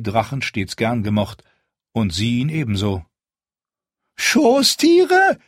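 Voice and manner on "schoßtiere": shrill, much higher, louder outraged shriek. A narrator's reading. Steward shrieking back at the sellsword captain, outraged screech. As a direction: screech the word in disbelief. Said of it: "kreischte Retznak".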